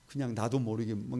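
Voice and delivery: normal talking voice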